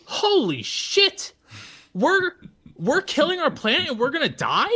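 a third grader suddenly terrified about the planet; panicked kid. Retold or imitated. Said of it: "so I was like".

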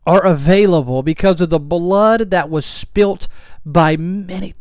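impassioned pulpit delivery, slow and strong; patriotic preaching. Real, not acted. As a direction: build the sermon with rising conviction.

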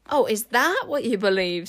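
arch, doubting question; sceptical. A narrator's reading. Enchanter doubting the queen's excuse, sceptical. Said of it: "raising an eyebrow".

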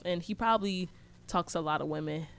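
usual speaking voice

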